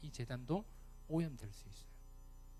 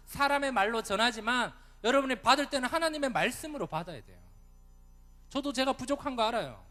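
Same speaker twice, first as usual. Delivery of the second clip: high, loud pleading sermon; full-force preaching; 7 semitones higher, 6 dB louder than usual. Real, not acted. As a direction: urge them to hear it as God's word.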